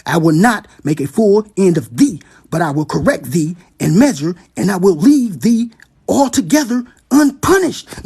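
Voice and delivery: slow, drawn-out proclamation; preacher driving the verse home; preaching scripture. Acted. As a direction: lean into every word. Reads the prophecy, preaching.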